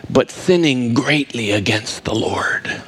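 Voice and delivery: hushed, slow, grave whisper. Acted. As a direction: drop to a whisper on the sin. Preacher drops to whisper.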